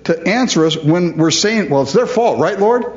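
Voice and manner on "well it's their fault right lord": high, pleading whine, seeking agreement; whiny plea. Retold or imitated. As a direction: wheedle for God to agree. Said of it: "we want the Lord to".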